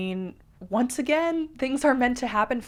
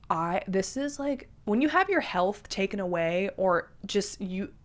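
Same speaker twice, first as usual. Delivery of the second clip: ordinary talk, nothing marked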